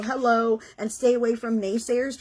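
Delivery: ordinary talk, nothing marked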